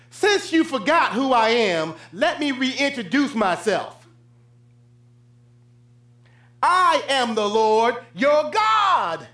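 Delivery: loud, building to a slow shout; booming God voice. Acted. Preacher voices God reintroducing himself, building to a slow shout. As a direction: proclaim it as God, ending in a shout.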